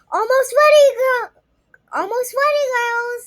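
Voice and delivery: put-on high, loud call to the girls; dad calling. Dad calling the girls to the show, bright and loud. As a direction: call them over cheerfully. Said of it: "Dad called with his voice warm".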